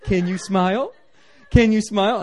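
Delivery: high, loud, playful coaxing; coaxing baby to smile. Retold or imitated. Preacher holding the baby, coaxing it loudly and playfully. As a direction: coax the baby into a smile, building up. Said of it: "I'm like".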